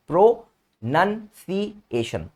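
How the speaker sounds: Pro नन सी एशन split into separate slow beats; pro नन सी एशन spoken slowly, one syllable at a time. Shown as a demonstration. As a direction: slowly chop the word into its syllables for the class. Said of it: "breaks word into syllables".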